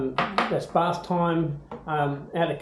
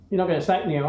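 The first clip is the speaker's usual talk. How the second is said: normal talking voice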